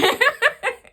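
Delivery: sudden high giggle at how weird it was; a real laugh bursting out high; high burst of laughter. Real, not acted. Reviewer laughing to viewers about a weird book, high giggle.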